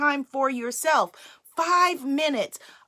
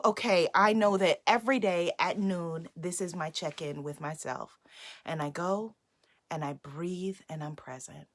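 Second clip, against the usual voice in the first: calm soft voice; 8 semitones lower, 8 dB quieter than usual. Shown as a demonstration. Demonstrating calm check-in.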